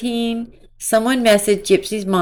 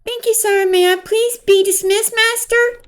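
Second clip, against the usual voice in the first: much higher, simpering little servant voice; mocking submissive voice; 13 semitones higher than usual. Mocking the couple's role-play, in a high simpering voice. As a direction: squeak it out like a meek servant.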